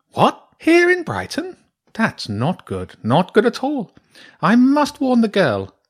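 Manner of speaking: high, startled badger voice; alarmed badger priestess. Badger priestess learning Rask is in Brighton, alarmed. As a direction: react sharply, then turn grave.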